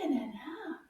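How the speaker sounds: usual speaking voice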